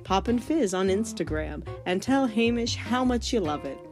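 normal talking voice

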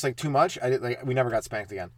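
ordinary talk

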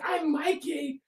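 put-on high, quiet child's voice; small high voice of a lost kid; little girl voice. The lost girl giving her name, high and soft.

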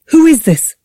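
high, loud, indignant question; angry demand. A narrator's reading. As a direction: demand an answer from the caller. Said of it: "Anger flared in Julia's chest".

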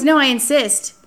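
high, loud insistence; insisting. Retold or imitated. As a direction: brook no refusal. Date insists on paying.

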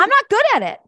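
candid, laughing at own weakness; emphatic admission. Real, not acted. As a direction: throw the word not up high and loud. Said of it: "Okay, let's be honest".

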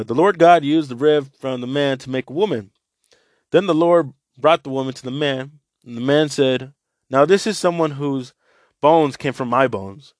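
scripture reading to listeners; Bible reading. Acted. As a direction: read the scripture out to the group. Reads Bible passage aloud.

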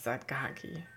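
usual speaking voice

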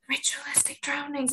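unvoiced flat whisper. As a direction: whisper it deadpan. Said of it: "Betty's just like".

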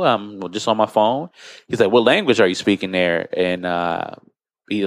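usual speaking voice

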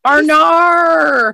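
loud, high exclamation of disbelief; shocked outcry. Real, not acted. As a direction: call out her name in shock.

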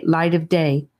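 ordinary delivery

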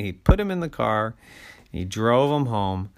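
plain reading voice

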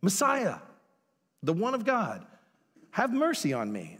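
high, pleading cry to be heard; a blind beggar crying out for help. A narrator's reading. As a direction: call out for mercy over a crowd. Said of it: "he just begins to cry out".

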